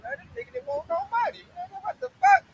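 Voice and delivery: very high, baffled squeak; mock confounded. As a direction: mimic a man stunned by rejection.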